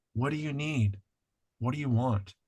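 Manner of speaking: quiet tender questions to the shadow; gentle soft asking. Retold or imitated. Facing the shadow, asking softly what it needs. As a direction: ask softly, like to a hurt part. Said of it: "you imagine shining a flashlight".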